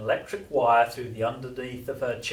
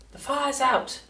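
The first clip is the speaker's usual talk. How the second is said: high, pleased grandson voice; storybook child declaring success; proud announcement; 12 semitones higher than usual. A narrator's reading. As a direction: announce it proudly, pitched well up. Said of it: "George announced proudly".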